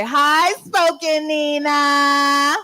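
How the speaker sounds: drawn-out crowd call hi Spoken Nina; call-out greeting. Pretend. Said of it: "And everybody go say".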